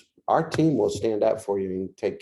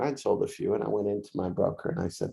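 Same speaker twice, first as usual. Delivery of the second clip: usual speaking voice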